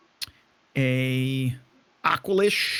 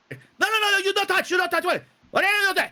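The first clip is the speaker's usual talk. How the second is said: much higher, shrill pleading little owner; frantic owner. Acted. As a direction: shriek at them to stop. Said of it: "owner's like".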